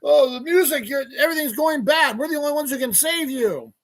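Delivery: high, hammed-up panic; mock anxious. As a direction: wail it like an overdramatic ad. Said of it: "anxious, anxious".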